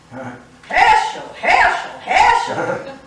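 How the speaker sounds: loud, rising call with a laugh; yelling back. Retold or imitated. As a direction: shout his name back, half laughing. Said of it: "And I yelled back".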